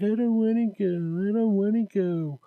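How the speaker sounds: put-on high, whining child refusing to go; whiny kid. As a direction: whine like a kid who won't go to class. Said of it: "they're like".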